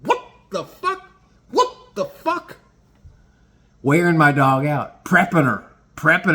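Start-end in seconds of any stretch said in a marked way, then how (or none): 0.0-2.6 very high, slow, halting squeak; drawn-out high-pitched mock dog bewilderment; bewildered dog's voice. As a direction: play a jostled dog squeaking in disbelief. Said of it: "my dog the whole time's going".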